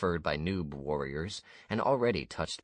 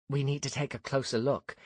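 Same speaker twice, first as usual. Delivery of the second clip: Ania's voice; 9 semitones higher than usual. A narrator's reading. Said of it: "she said".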